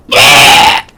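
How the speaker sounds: loud sudden yell; shout. Pretend. Masked kid yelling at the householder, loud. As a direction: bark it out to startle. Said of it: "I just go".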